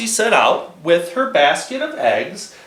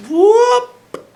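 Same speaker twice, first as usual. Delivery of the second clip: high whoop; 6 dB louder than usual. Acted. Playful exclamation to kids.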